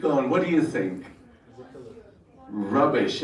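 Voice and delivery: ordinary talk, nothing marked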